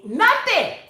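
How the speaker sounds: high, loud burst of indignation; indignant outburst. Real, not acted. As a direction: punch it out, outraged at being unpaid. Indignant about not being paid.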